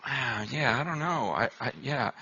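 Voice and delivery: low, soft, trailing "I don't know"; evasive mumble. Retold or imitated. Professor dodging the student's complaint, softly evasive. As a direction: dodge the question quietly.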